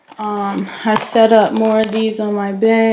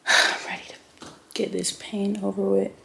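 whispering; 8 dB quieter than usual. Real, not acted. Sore and tired after surgery.

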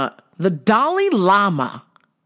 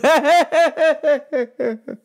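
laughing; 7 semitones higher than usual. Real, not acted. Host cracking up at himself, laughing. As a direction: burst of laughter.

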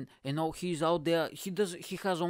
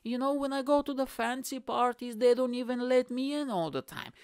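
mock sob story; 7 semitones higher than usual. Poor billionaire begs pity over fancy parties, mock-tearful pleading. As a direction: plead pitifully, hammed up. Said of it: "they have, like, a really sad music".

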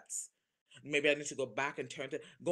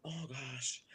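ordinary talk, nothing marked

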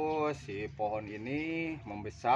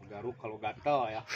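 ordinary talk

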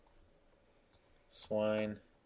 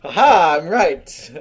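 loud mock gloating; 7 semitones higher, 13 dB louder, slower than usual. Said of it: "I'm like".

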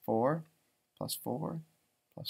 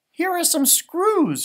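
higher and slow, a hint of cheerful mom; lightly voiced mom; 12 semitones higher, 8 dB louder, slower than usual. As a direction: offer it up like a bright mom. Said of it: "she said".

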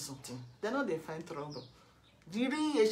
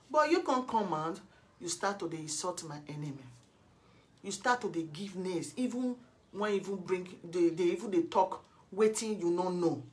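ordinary talk, nothing marked

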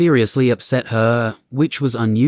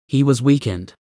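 plain reading voice

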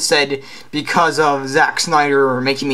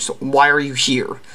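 ordinary talk, nothing marked

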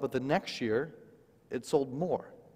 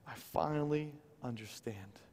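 tearful whisper; 2 semitones lower, 9 dB quieter than usual. Retold or imitated. Wife weeping while signing books.

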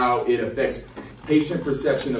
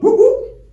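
high, loud mouth-made police siren whoop; siren imitation; 10 dB louder than usual. Retelling the police stop, loud siren whoop. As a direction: wail it out like a cop car's siren. Cop car pulls up.

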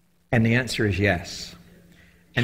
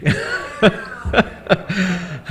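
amazed laugh; 8 semitones higher than usual. Real, not acted. Amazed at the boat name.

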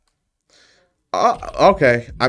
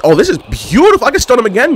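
excited; 11 semitones higher, 5 dB louder than usual. Real, not acted. Excited at game moment.

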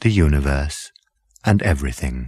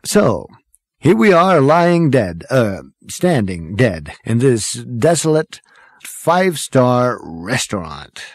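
raised, theatrical delivery slowing at the end; breezy braggart; 4 semitones higher than usual. A narrator's reading. Zaphod surveys the restaurant while dead, breezy. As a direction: sum up grandly, stumbling midway. Zaphod sums up their plight.

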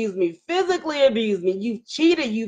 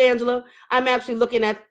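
usual speaking voice

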